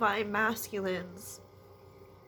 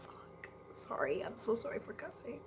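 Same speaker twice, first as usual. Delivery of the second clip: whisper; 3 semitones higher, 7 dB quieter, faster than usual. Real, not acted. Overwhelmed reader apologising in whisper.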